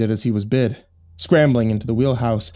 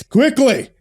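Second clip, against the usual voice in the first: yelling; 10 semitones higher, 4 dB louder than usual. A narrator's reading. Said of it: "the captain yelled".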